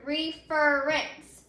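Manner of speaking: "reference" drawn out slowly, syllable by syllable; "reference" stretched out for a class to hear; sounded out. Shown as a demonstration. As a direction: say "reference" very slowly as REF-er-ence. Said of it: "So let's say that word".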